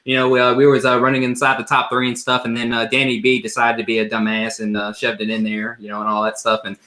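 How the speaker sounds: a hint of a Southern driver giving a post-race interview; mock Southern racer talking to the press; Southern accent. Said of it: "let me get a Southern accent voice".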